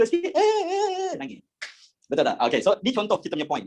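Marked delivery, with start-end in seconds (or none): 0.0-1.5 high whimpering eh eh eh then a crumpled sob; mock crying man. Teacher mocking a macho man who whimpers over a small cut. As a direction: whine like a big baby over a scratch.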